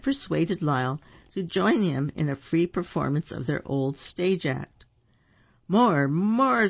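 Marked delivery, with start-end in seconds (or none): 5.7-6.7 loud, high, drawn-out crowd call; delighted calling out. A narrator's reading. Delighted shoppers call to the performers for more, calling out. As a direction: call for an encore like thrilled shoppers. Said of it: "surprised but delighted shoppers called out".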